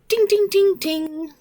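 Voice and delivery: high, ringing dings; imitated bike bell. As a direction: chime like a child's bicycle bell. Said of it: "playing with the little bell".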